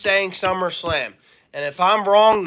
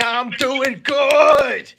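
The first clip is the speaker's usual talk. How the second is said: high, hugely drawn-out reply; hammed-up shout; 6 semitones higher, 3 dB louder, much slower than usual. Answering Miss Mangini's greeting, hammed-up drawn-out shout. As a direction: stretch it out in a playful holler.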